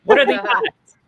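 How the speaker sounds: high, loud squeal; mock amazement. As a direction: sell the punchline with wide-eyed glee. Punchline, mock wonder.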